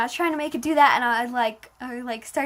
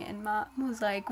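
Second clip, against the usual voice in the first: normal talking voice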